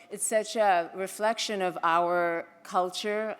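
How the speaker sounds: usual speaking voice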